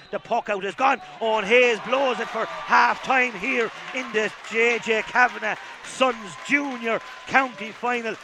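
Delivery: loud, excited commentator call; big, punchy sports commentary; live match commentary. Real, not acted. Live half-time match commentary.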